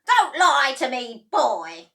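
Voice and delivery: high, very loud snarl; hag shouting. A narrator's reading. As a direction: shout the boy down. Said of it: "the enormous old hag shouted".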